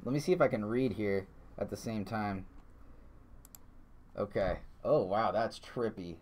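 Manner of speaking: ordinary talk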